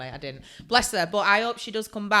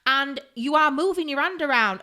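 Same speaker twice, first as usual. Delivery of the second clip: loud, raised-pitch exasperated rant; angry retort; 7 semitones higher, 8 dB louder than usual. Retold or imitated. Daughter doing mum's nails in thirty minutes, angry retort. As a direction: argue back heatedly, hammering the reasons. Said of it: "why are you so angry?".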